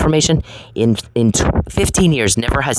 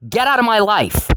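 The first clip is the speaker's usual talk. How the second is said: pitched way up, fed up and furious; angry high voice; 9 semitones higher than usual. Real, not acted. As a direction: fling it at him, done with him. Escalating confrontation, repeated demand.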